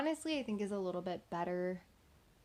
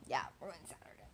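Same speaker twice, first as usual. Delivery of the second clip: ordinary talk, nothing marked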